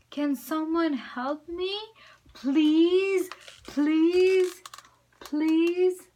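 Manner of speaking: high, loud begging please; pleading cry. Acted. Bird begs louder.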